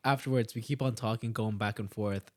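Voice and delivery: usual speaking voice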